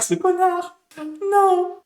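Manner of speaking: high-pitched outraged squeal; high exasperated cry. Real, not acted. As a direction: squeal in disbelief. Reacts to character's move.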